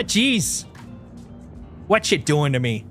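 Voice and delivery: ordinary delivery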